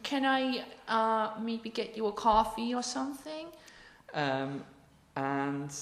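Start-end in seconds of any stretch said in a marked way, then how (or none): 0.0-3.5 high-pitched mock New York accent; put-on apologetic New York woman. Woman offering Alfred coffee, high New York accent. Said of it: "very thick New York accent".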